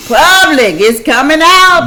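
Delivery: high, loud teasing; playful excited tease. Real, not acted. Teasing, laughing.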